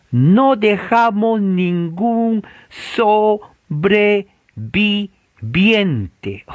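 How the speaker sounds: high, deliberate scripture reading; drawn-out emphatic reading. Acted. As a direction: stretch each syllable for dramatic emphasis.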